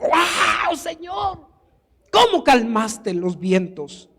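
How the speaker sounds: high, drawn-out amazed wow, then slowing; high-pitched marvelling, without real volume; acted euphoric cry. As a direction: imitate an overawed disciple crying out. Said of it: "fue Judas el que gritó".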